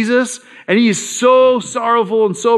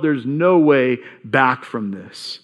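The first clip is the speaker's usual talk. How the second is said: despairing, slow; 6 semitones lower, slower than usual. Retold or imitated. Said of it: "the feeling that he would have felt".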